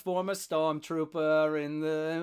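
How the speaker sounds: slow, exaggerated Boston accent; Bill Burr. Host posing as a former stormtrooper, hammed-up Boston accent. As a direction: drag out a comedian's Boston accent. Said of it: "Bill Burr's accent comes and goes".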